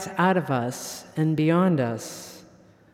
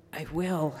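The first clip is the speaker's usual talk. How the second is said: choked up; 4 semitones lower, 10 dB quieter than usual. Acted. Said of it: "from a throat that contained a stone".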